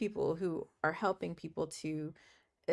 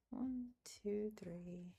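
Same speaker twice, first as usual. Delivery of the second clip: softly whispering the count to herself; hushed counting under the breath; whispered counting; 12 dB quieter, much slower than usual. Real, not acted. Counting puzzle shapes to herself.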